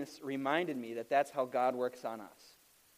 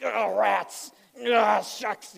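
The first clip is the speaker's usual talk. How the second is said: higher, louder frustrated mock oaths; mock cursing; 5 semitones higher, 6 dB louder, much slower than usual. Pastor fake-cursing at a stuck lawnmower belt, high and louder. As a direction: swear without swearing, hammed up. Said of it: "curse words that aren't curse words".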